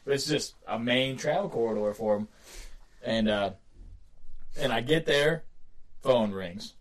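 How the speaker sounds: ordinary talk, nothing marked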